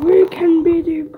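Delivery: ordinary delivery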